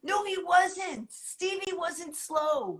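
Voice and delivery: slow, high-pitched objection; drawn-out protest. Real, not acted. Defending Stevie to the co-host, drawn-out protest.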